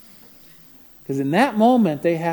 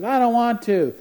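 higher, louder whiny refusal; mock defiant kid; 10 semitones higher, 7 dB louder than usual. Son refusing his mother from the cul-de-sac, defiant. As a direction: refuse like a sulky twelve-year-old.

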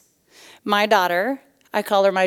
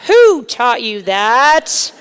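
loud, slow mock demand; indignant mom; 7 dB louder, much slower than usual. Mom questioning her preaching daughter, mock indignation. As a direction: draw it out in mock outrage.

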